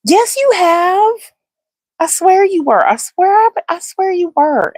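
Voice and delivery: high, loud, sing-song protest; playful insistence. Real, not acted. Insisting to a viewer in chat.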